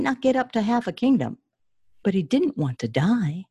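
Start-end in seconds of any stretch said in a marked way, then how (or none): none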